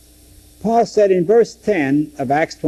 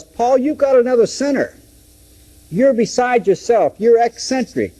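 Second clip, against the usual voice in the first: mock accusation; 9 semitones higher than usual. Festus accusation paraphrased.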